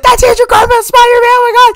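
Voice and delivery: very high squealing oh my God; excited squeal. Retold or imitated. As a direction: squeal like the crowd losing it. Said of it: "everybody's like".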